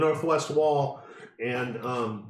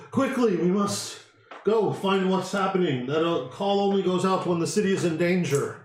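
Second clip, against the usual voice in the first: urgent, higher-pitched town leader; alarmed Burgermeister; 6 semitones higher than usual. Acted. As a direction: send them off urgently to face danger. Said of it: "the Burgermeister looks around".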